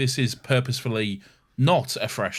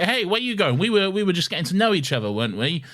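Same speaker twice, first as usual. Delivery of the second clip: chirpy Clara; 8 semitones higher, faster than usual. Retold or imitated. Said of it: "And then she's like".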